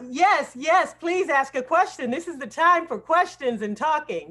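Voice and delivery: ordinary talk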